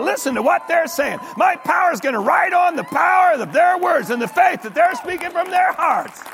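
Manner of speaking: high, loud, fast excited call to the angels; God calling his angels, excited and loud; God voiced by preacher. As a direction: summon the angels, thrilled. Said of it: "God would say".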